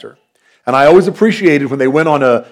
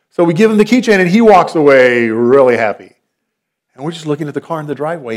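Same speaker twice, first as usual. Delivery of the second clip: ordinary talk, nothing marked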